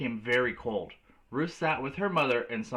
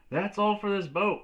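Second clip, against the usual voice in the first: officer's call; 8 semitones higher, 3 dB louder than usual. Acted. Officer closing the lifeboat.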